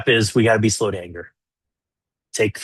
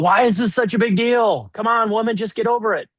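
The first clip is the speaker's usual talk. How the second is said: much higher, mocking whine; dismissive defending; 11 semitones higher than usual. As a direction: brush off the wife's complaint. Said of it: "It's not defending".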